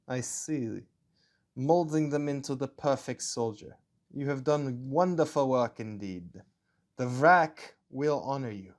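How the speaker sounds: measured, put-on German accent; a German officer with hints of Russian. Officer commending the scientist, German accent. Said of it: "my German accent slips a little into Russian".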